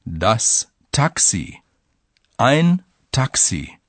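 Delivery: slow, careful teacher reading; slow and clear. Shown as a demonstration. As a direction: spell each word out slowly. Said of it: "Listen to an example".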